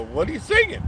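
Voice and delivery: high mock surprise at a singing clerk; amused surprise. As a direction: squeak it out in disbelief. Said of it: "and I go".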